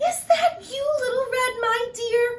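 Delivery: put-on high, loud call of an old woman; quavery high granny call. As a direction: call through the door like Granny. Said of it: "called Granny".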